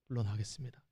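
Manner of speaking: 조용하고 담담한 사과 흉내; 낮고 작게 깔끔히 물러나는 말; 깔끔한 사과. Retold or imitated. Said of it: "깔끔하게". (잘못을 인정하는 사람의 조용하고 담담한 사과)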